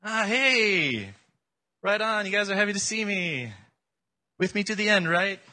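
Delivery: high-pitched mock-happy greeting; sarcastic. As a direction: fake cheer, dripping with sarcasm. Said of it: "I'd be sarcastic".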